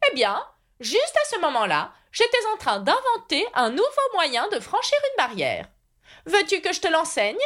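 high-pitched, self-satisfied knight; eccentric knight, proud inventor. A narrator's reading. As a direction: boast earnestly in a high character voice. (chevalier inventeur fier de lui, voix haut perchée)

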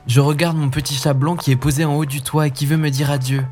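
just a hint of a comforting little brother; Hansel. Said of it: "Qu'est-ce que tu regardes".

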